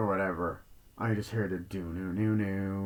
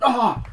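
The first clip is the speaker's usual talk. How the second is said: short, high, loud startled scream; jump-scare scream; 14 semitones higher, 10 dB louder than usual. Retold or imitated. Mimicking the jump scare in the film, sharp high scream. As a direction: yelp like you got jumped.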